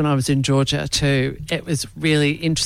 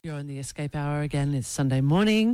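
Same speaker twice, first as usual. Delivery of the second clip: soft, smooth radio host read; 4 dB quieter than usual. Acted. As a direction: ease into it like a Sunday morning DJ. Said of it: "God, that's a great radio voice you've got".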